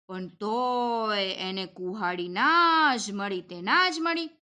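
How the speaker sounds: plain reading voice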